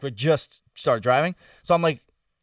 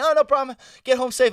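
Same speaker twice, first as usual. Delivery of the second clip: friendly driver; 10 semitones higher, 4 dB louder than usual. Retold or imitated.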